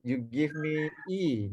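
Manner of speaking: ordinary talk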